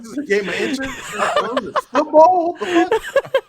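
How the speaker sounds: high, loud questions through laughter; helpless laughter, voice shooting up; laughing incredulously. Real, not acted. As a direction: crack up while echoing it in disbelief. Cracking up at a muddled remark.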